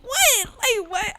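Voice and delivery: a squeak of outrage at being told to calm down; shocked what. Retold or imitated. Girl reacting to mama saying calm down, stunned high squeak. Disbelief at mama's reply.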